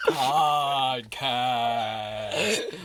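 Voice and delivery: drawn-out intro. Pretend. Co-host answering with the intro word, drawn out. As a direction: stretch the word out slowly to a lift.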